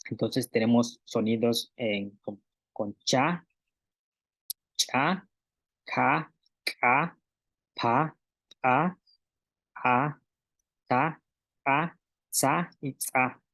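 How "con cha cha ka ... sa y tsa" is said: "cha", "ka", "pa" con cierre glotal marcado, despacio; sílabas con glotal. Shown as a demonstration. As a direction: golpe de glotal claro en cada sílaba. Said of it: "con este contraste que hago". (maestra muestra el contraste de consonantes glotalizadas)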